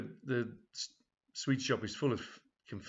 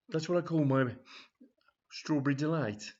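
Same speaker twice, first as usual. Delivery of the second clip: a little higher and louder, leering; suggestive assistant; 5 semitones higher, 4 dB louder than usual. Acted. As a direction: savour the double meaning. Assistant names his innuendo.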